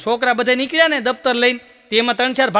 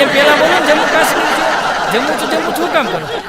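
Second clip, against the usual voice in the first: laughing exasperation; 4 semitones higher than usual. Retold or imitated.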